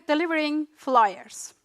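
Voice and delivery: ordinary talk, nothing marked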